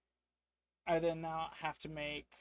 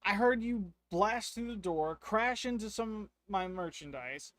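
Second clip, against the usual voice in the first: angry merchant; 4 semitones higher, 6 dB louder than usual. Acted. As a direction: bark at the customer like a merchant. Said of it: "He goes".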